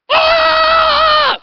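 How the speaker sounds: piercing high battle scream at full volume; all-out berserk gnome shriek, mic peaking; gnome's scream. Acted. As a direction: let the gnome shriek as he lunges. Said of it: "Can you scream again?".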